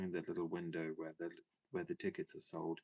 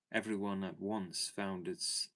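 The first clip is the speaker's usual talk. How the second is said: ordinary delivery, nothing marked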